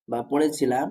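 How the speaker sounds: usual speaking voice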